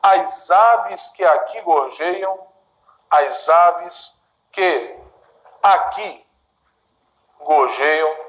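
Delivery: slow, measured poem reading; poem recitation. Shown as a demonstration. Teacher reciting Gonçalves Dias to students, slow and measured. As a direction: recite each line slowly for the class.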